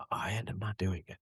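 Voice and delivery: hushed unvoiced confession; whispering. Real, not acted. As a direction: drop to a whisper. Confessing a personal failing.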